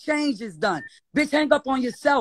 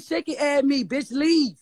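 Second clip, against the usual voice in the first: normal talking voice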